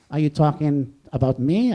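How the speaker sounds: ordinary talk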